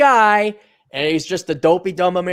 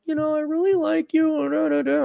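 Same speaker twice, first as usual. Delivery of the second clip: imitating girls; 12 semitones higher, 3 dB quieter than usual. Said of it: "they were like".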